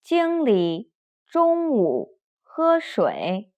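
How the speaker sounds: slow, carefully toned Mandarin sentence; slow phrase-by-phrase drill; read-after-me model. Shown as a demonstration. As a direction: model it slowly for learners to repeat. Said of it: "Please read after me".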